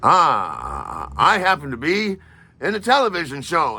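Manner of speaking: high, self-important mock bragging; imitating a puffed-up TV personality; pompous. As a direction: announce it as a pompous boob. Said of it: "You pompous boob".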